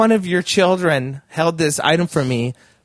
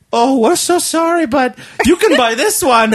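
high, louder, overly sorry; hammed-up apology from the sellers; mock apologetic seller; 10 semitones higher, 5 dB louder than usual. Quoting the sellers' sorry offer in a high mock voice.

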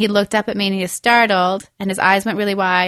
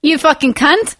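higher fake Australian insult; indignant cartoon Australian dad; mock Australian accent; 7 semitones higher, 3 dB louder than usual. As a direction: curse in a hammed-up Australian accent. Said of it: "But he's Australian".

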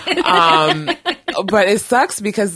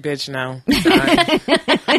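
blunt, dismissive no; curt refusal; 6 semitones higher, 4 dB louder, much slower than usual. Retold or imitated. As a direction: shut him down flat. Rude refusal to customer.

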